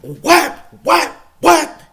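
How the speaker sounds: loud, high challenge; taunting what. Retold or imitated. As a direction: goad the opponent. Taunting opponent mid-fight.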